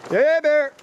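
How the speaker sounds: high loud call of hey bear; scaring off a bear. Real, not acted. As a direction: shout it out to warn bears. Calling out to warn bears.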